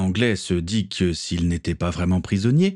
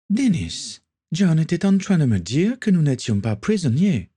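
put-on higher, lively young lady; Kathleen's voice; 9 semitones higher than usual. A narrator's reading. Kathleen telling her brother Denis the news, higher voice. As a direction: call her brother in a raised voice.

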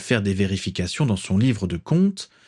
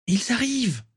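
put-on high, eager girl's cry; excited exclamation. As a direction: call it out with glee. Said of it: "s'exclama Daisy".

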